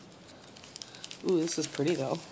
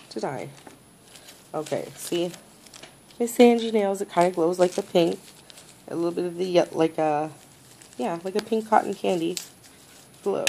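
ordinary talk

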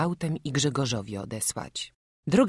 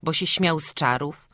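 ordinary delivery, nothing marked

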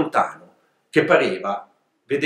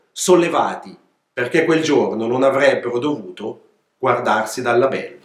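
plain reading voice

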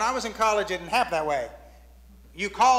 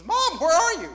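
calling out; 8 semitones higher, 5 dB louder than usual. Retold or imitated. Son calling mom from payphone.

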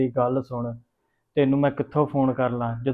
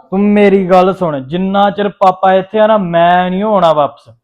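louder, higher angry insistence; angry refusal; 5 semitones higher, 9 dB louder than usual. Acted. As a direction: put your foot down, furious. Refuses to return while father's there.

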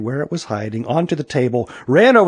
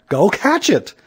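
high, urgent command; urgent order; 8 semitones higher than usual. A narrator's reading. Mother sending Aaron after his runaway hair, urgent order. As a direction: send him off urgently, pitched high.

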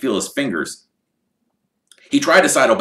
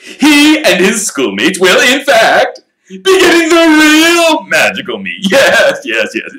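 showman voice; 13 semitones higher, 10 dB louder than usual. Acted. Lockhart's big announcement.

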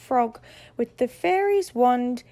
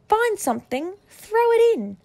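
urgent orders; 7 semitones higher, 3 dB louder than usual. A narrator's reading. Fairy gives urgent orders.

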